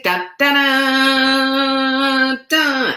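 loud sung da da da; hummed spy theme. Pretend. Mimicking the Get Smart theme music, sung loud. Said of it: "you hear the Get Smart music".

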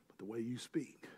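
breathy dramatic whisper. Acted. Pastor warns the church about their speech, whispering. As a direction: drop to a whisper to land the point.

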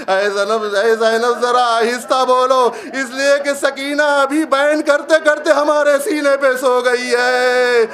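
keen it from the severed head's voice; a father's voice hushing his sister, high and grieving; mournful call. Acted. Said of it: "یہ آواز آئی".

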